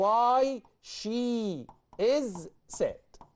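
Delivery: very slow, stretched correct word order; slow correct form. Shown as a demonstration. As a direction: model the right order slowly. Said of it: "ما أقولش is she، أقول".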